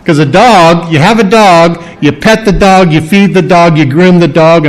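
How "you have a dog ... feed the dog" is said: ordinary talk, nothing marked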